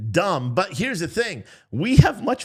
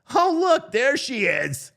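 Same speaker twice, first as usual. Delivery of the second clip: mock excitement; 9 semitones higher, slower than usual. Host spotting the press secretary in the clip, mock excitement. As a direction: exclaim in pretend excitement, pitched way up.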